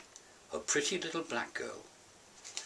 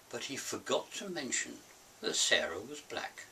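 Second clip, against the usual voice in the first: ordinary talk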